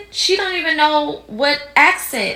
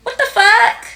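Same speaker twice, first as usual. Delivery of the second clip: high, loud disbelief; shocked outburst; 12 semitones higher, 6 dB louder than usual. Real, not acted. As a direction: yelp it in outrage. Outraged reaction to her own impression.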